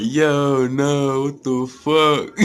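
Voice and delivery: high, slow laughing disbelief; giggling stunned reaction. Retold or imitated. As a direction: laugh through the words in shock. Replaying his inner reaction.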